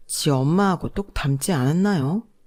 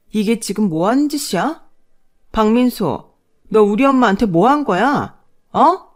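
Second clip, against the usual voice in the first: rising furious confrontation, louder to the end; sharp angry daughter; 5 semitones higher, 5 dB louder than usual. Acted. Daughter confronting her husband, sharp and angry. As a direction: demand an answer, voice climbing to a shout. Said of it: "딸의 매서운 소리에".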